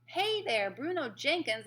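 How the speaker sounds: loud, high hailing call. A narrator's reading. Mouse boy trying again to find Bruno, calling out. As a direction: hail someone far off, voice up. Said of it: "I called".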